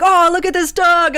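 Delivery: high, bright-eyed delight at a dog. Acted. Tavern patrons spot the fox and gush, excited.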